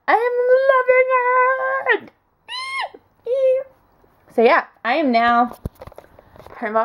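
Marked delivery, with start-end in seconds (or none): 0.1-2.1 high, slow gush. Real, not acted. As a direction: stretch it out with delight.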